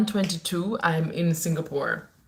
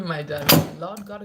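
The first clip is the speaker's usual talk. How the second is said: ordinary delivery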